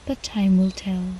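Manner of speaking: usual speaking voice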